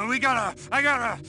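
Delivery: impatient excited outburst; eager. Acted. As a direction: itch for destruction. Eager clone wants action.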